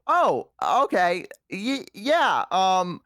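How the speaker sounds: high, hesitant, drawn-out mock politeness; awkward goodbye. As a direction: stall uneasily, then stretch out the farewell. Retold awkward goodbye to a worrying date.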